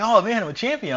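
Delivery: loud, high joyful cry; excited shout. Retold or imitated. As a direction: shout it to your coach. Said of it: "I'm telling my coach, I'm grabbing him".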